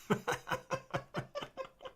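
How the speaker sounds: high-pitched burst of laughter; embarrassed high laugh. Real, not acted. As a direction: laugh at yourself after the plug. Laughs at own plug.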